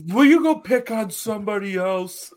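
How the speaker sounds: high, far put-on whiny voice; mocking the angry dock owner. Retelling how the dock owner yelled at them. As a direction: squeak it out like a grumpy homeowner. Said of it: "The guy comes around".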